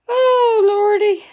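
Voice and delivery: very high, loud mock dismay; dramatic exclamation. Real, not acted. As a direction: wail it out in exaggerated shock. Reader reacts to punishment.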